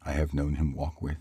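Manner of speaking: plain reading voice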